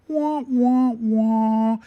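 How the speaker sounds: loud, high mock sad-trombone wah wah wah. Telling viewers Malik missed last week, mock sad-trombone wah. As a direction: moan it out like a sad trombone after a letdown.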